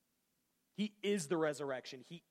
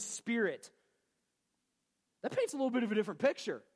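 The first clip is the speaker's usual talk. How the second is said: louder, higher preaching with force; fired-up preaching; 4 semitones higher, 4 dB louder than usual. Real, not acted. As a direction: preach it with rising passion.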